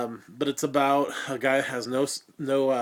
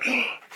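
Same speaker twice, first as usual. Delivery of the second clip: quick, high theatrical gasp; mock gasp; 12 semitones higher than usual. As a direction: gasp as if scandalized. Dramatic reveal of suicidal.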